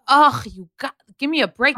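mildly exasperated scoff; retold exasperation. Retelling her reaction to offended people, mildly exasperated.

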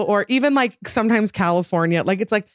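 ordinary talk, nothing marked

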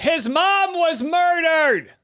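high, slow outraged shout; indignant shout. Real, not acted. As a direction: shout it in disbelief. Heated defense of Prince Harry.

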